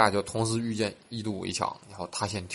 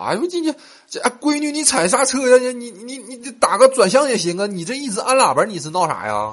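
high, loud, flustered shout at a student driver; panicked instructor yelling; 10 dB louder than usual. Retold or imitated. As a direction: yell in alarm as the car heads for a tree. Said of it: "教练就在旁边喊了".

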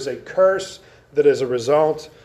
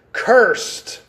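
CURSED set apart, punched higher; stress on CURSED; 5 semitones higher, 4 dB louder than usual. Shown as a demonstration. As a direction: hit "cursed" hard and high, alone between pauses. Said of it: "Key emphasis on the word cursed".

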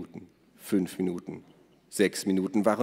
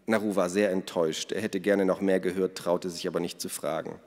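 usual speaking voice

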